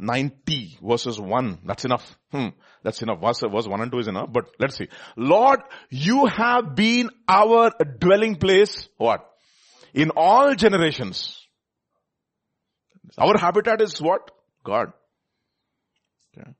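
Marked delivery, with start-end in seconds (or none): 5.1-11.4 loud, slow, declaimed scripture; stately, drawn-out preaching read; emphatic psalm reading. Acted. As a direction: proclaim each word slowly, voice raised.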